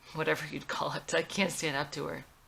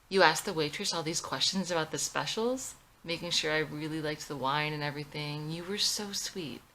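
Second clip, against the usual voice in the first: plain reading voice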